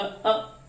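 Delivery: a quick, clipped short अ; teacher demonstrates the short vowel, one beat only. Shown as a demonstration. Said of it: "ह्रस्व स्वर कैसे बोला?".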